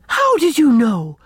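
aghast. A narrator's reading. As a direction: blurt it out, caught off guard. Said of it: "cried Anne".

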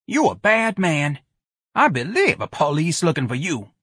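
high-pitched indignant charge; angry accusation. Acted. Choir's spokeswoman turning on Ignatius, angry. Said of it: "said angrily to Ignatius".

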